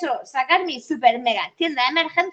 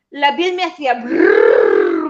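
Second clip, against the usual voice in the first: slow, louder trilled brrrr; brrr vibrating sound; 4 semitones lower, 8 dB louder, slower than usual. Retold or imitated. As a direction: roll a loud, slow brrrr. Imitating skin vibrating from thunder.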